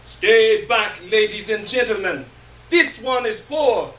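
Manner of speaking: loud shout, the last line slowed; screaming warning. Acted. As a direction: bellow it like a warning sign. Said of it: "It screams".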